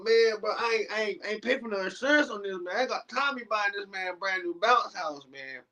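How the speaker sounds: high, excited worry; high mock panic over the bounce house. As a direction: fret half-laughing.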